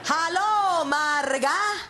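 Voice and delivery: put-on slow, loud, patronizing Utah accent; loud slow mock accent. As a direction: overenunciate as if she can't hear. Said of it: "talk to me in an accent, right? Really loud".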